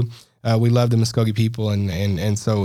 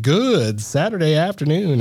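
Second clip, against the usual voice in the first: slow, higher radio host greeting; rehearsing his old news sign-on, slow and bright; announcer greeting; 5 semitones higher, slower than usual. Shown as a demonstration. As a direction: practise the old show opener. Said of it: "doing my little own practicing".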